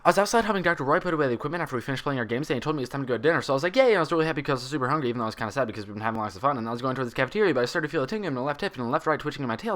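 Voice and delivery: fast, breathless high babble; one long breath. Acted. Pinkie explaining to Applejack why she wandered, all in one breath. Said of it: "exhaled her answer as one long sentence".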